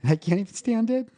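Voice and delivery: high, strained, on the edge of tears; real emotional. Real, not acted. Father telling host he misses his boys, emotional. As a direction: let missing them push the voice up high. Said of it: "didn't mean to get all emotional".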